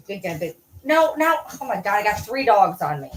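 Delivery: usual speaking voice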